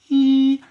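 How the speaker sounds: high, loud grinning eee; bare your teeth and squeal; toothy grin squeal. Acted. Shows big toothy smile.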